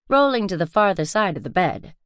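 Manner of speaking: usual speaking voice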